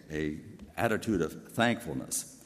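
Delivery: ordinary delivery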